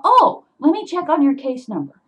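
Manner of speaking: high, loud, eager reply; sudden recognition. Retold or imitated. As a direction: imitate an official who instantly knows the caller. Acts police recognizing the caller.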